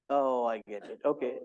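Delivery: ordinary talk, nothing marked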